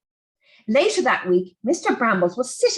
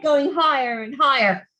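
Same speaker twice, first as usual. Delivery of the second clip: ordinary delivery, nothing marked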